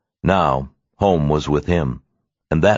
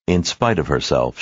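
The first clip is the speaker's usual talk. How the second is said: ordinary delivery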